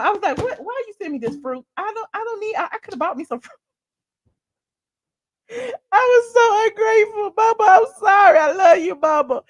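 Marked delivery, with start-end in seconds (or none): 0.4-3.6 high, soft mock complaint; retold mad whining. Daughter complaining to mama about fruit, mock whining. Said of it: "I was so mad at my mama". 5.5-9.4 high-pitched hammed-up crying; mock tearful apology. Ungrateful daughter apologizing to mama, mock crying.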